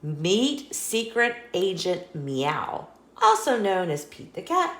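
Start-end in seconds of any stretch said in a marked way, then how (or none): none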